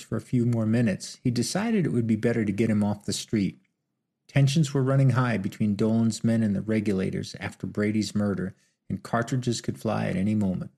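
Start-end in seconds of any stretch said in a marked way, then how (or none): none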